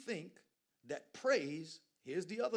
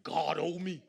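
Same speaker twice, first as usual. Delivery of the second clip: loud, entitled mock claim; 3 semitones higher, 11 dB louder than usual. Mocking American Christians' entitlement, loud sneer. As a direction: sneer it like you're owed. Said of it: "we feel".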